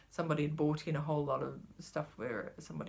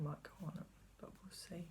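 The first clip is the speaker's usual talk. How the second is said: quick hushed aside; whispered musing; 13 dB quieter than usual. Real, not acted. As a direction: think aloud in a fast whisper.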